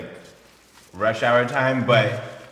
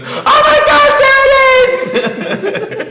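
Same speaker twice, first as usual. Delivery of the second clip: sudden loud high shout of delight; 13 dB louder, much slower than usual. Retold or imitated. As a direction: blurt it out at the top of your voice. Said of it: "he shouts immediately".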